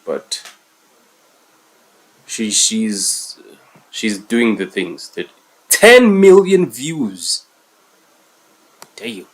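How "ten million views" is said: high, loud, slow mock awe; sarcastic drawl. Real, not acted. Reactor mocks the storyteller chasing views, sarcastic. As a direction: stretch it out mockingly.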